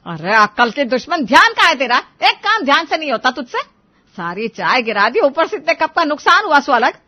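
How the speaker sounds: loud, high furious telling-off; angry scolding. Acted. Mother-in-law scolds daughter-in-law over spilled tea, furious loud. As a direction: scold her hard for the broken cups.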